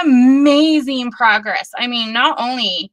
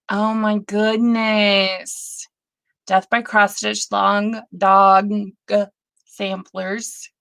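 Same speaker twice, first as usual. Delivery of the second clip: ordinary talk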